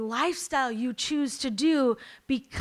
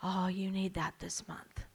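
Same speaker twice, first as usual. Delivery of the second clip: soft unvoiced whisper of a little inner voice; the little voice in my head whispering; tempting whisper; 5 semitones lower, 7 dB quieter than usual. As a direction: whisper it like a doubt creeping in. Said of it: "little voice in my head that said".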